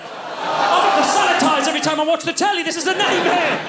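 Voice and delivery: high, fast, shouted panic; spiralling panic, fast and high; frantic rant. Real, not acted. Ranting panic.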